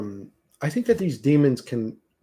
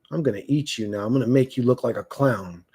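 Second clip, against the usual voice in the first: usual speaking voice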